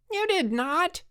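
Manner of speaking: very high, indignant retort; high-pitched denial. A narrator's reading. Jack arguing with Frank over the tag, indignant. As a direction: squeal it back stubbornly.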